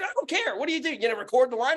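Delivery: scornful retort, pitched very high; freaking out. Retold or imitated. Landlord retells scoffing at recording threat, heated high voice. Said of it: "I'm freaking out".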